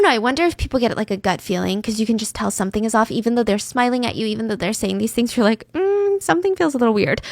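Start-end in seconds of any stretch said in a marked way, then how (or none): 5.7-7.2 high, hesitant "mm" of doubt; suspicious hunch. Retold or imitated. Listener sensing something is off, doubtful. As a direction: voice a wary gut feeling. Acting out a suspicious gut feeling.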